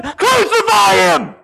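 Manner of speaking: high, slow, drawn-out shout; mob yelling. Retold or imitated. Preacher voicing the crowd turning on Jesus, imitated mob yelling. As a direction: imitate a mob calling for blood. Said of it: "were gonna be yelling".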